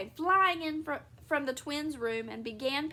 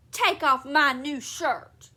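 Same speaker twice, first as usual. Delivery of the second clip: loud, slow, emphatic command; angry order; 8 dB louder, much slower than usual. A narrator's reading. Husband ordering wife about shirt, loud angry command. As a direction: demand it forcefully, stretching the words.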